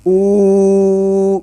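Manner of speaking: او held long and steady. Shown as a demonstration. Teacher shows the long oo. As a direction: stretch او out. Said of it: "حمزہ پیش وا".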